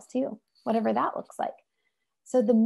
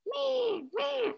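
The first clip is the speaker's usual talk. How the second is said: much higher, soft imitated baby whine; baby whimper; 7 dB quieter than usual. Imitating a baby crying for help in the room, a high soft whine. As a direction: squeak like a fussing baby.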